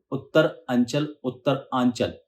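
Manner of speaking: उत्तर अंचल and Uttar Aanchal with a break after Uttar; split into parts. Shown as a demonstration. Teacher showing two ways to split the word. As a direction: pause after Uttar each time. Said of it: "जैसे भी आपको सही और आसान लगे".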